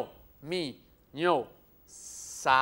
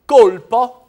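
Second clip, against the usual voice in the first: dramatic emphasis; 6 semitones higher, 18 dB louder than usual. Acted. Dictating a striking word.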